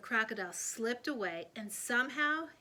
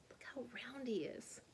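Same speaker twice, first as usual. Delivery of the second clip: unvoiced, much softer whisper; 9 dB quieter than usual. A narrator's reading. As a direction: breathe it in hushed awe. Awed hush at full crocodile.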